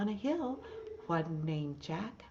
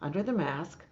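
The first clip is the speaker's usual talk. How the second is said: lively, loud storybook reading with animal voices; reading to children; 9 dB louder than usual. Acted. Teacher reading Little Blue Truck's Halloween to the class, lively loud reading. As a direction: read it big and bright for little listeners. Reads picture book aloud.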